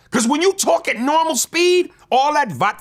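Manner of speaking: loud, high-pitched mock exasperation; worked-up comic protest; exasperated complaint. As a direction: pitch it way up, fed up with the listener. Acted complaint about a thick accent.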